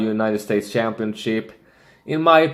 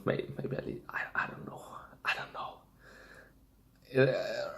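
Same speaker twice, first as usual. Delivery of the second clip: whispered, slow, second-guessing himself; unsure whisper; 2 semitones higher, 16 dB quieter than usual. Real, not acted. Wrestling pundit torn between picks, whispering to himself. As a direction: drop to a breathy whisper of doubt.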